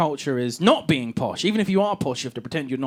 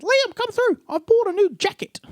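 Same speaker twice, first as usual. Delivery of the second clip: very high, excited mock-female call; wife calling out; 3 dB louder than usual. Wife calls husband to the hallway, high calling-out voice. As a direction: call through as an imitated wife, pitched way up. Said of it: "she called me through to the hallway".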